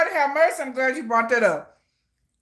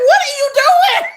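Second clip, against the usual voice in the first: shocked shout; 7 dB louder than usual. Real, not acted. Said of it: "reacting to the stream".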